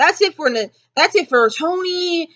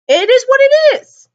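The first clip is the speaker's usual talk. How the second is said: loud exclaim; 11 semitones higher, 6 dB louder than usual. Real, not acted. Repeated resigned phrase.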